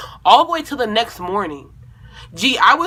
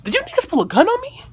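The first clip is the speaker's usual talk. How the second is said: high, fast, stunned question; shocked disbelief; 11 semitones higher, faster than usual. Retold or imitated. Retelling the moment a gun was pulled, stunned high disbelief. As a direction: blurt it out in shock.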